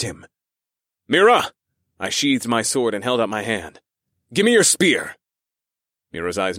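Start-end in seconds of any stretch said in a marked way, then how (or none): 1.1-1.5 loud, high call. A narrator's reading. Calling out to Mira. 4.3-5.2 urgent command. A narrator's reading. Demanding weapon in battle.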